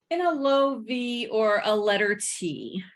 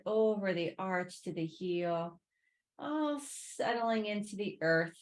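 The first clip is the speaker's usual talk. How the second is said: guided relaxation; 7 dB quieter than usual. Real, not acted. Yoga teacher guiding students to sink into the earth, soothing guided relaxation. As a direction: ease them gently down, unhurried and quieter. Guides a body scan lying down.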